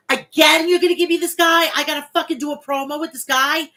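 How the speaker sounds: high, loud exasperated mock complaint; Jey Uso exasperated. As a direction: whine it loud like a fed-up wrestler. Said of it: "he's like".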